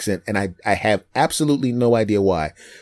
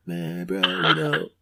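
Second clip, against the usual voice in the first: low, soft surfer-dude mumble; Southern California surfer; 6 semitones lower, 5 dB quieter, slower than usual. Retold or imitated. Surfer friend answering in Hollywood, laid-back and low. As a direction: imitate a mellow surfer bro. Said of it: "he was the stereotypical Southern California guy".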